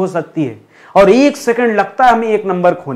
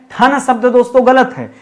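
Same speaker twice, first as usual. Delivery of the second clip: ordinary delivery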